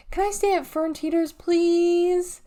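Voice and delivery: beg, stretching the please; begging. Acted. Tallahassee begs her mom for a sleepover, pleading high. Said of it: "Tallahassee begging".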